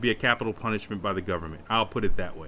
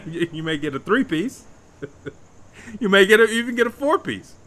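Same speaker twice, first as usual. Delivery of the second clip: laughing; 9 semitones higher, 5 dB louder than usual. Real, not acted. Joking about KFC pieces.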